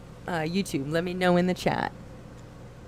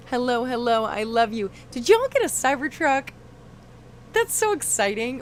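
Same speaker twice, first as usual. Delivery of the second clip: excited greeting; 6 semitones higher than usual. Real, not acted. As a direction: greet them with delight. Greeting and Cybertruck news.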